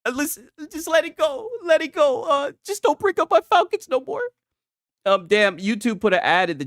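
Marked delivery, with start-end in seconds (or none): 0.1-4.2 high, whiny, hurt fan voice; mock pleading. Streamer begs chat to stop mocking his Falcons. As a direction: beg them to drop it, pretend-wounded. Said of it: "You're cooking my ass".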